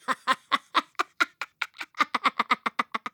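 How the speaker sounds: high staccato witch cackle; old woman's laugh. A narrator's reading. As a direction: cackle in high, separate bursts. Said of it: "La vecchia rise".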